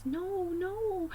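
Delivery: a pained, pleading no; sad teacher. Retold or imitated. Teacher sadly seeing the test half done.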